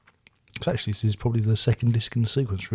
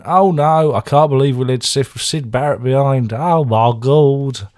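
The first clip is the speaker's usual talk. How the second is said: loud, higher mock-horrified cry, slowing at the end; mock panic; 4 semitones higher, 8 dB louder than usual. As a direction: ham up a drummer's horror. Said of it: "and he's going".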